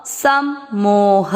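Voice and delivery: सम मोह spoken very slowly, syllable by syllable; slow syllable demo. Shown as a demonstration. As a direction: spell out सम मोह slowly for students. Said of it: "ह में वापस अ mix करेंगे". (सम मोह धीरे-धीरे अलग-अलग बोलें)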